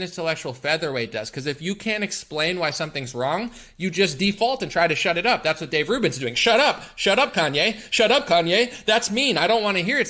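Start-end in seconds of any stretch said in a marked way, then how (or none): 6.3-10.1 mocking, loud high-pitched outburst; Ben Shapiro. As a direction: cut someone off, loud and high, in mock protest. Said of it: "says Ben Shapiro".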